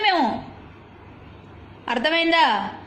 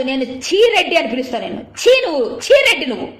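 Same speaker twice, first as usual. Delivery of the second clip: disgusted angry shout; 4 dB louder than usual. Real, not acted. Actress berating Sri Reddy with a mocking nickname, disgusted shout. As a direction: spit the insult out, rising into a yell.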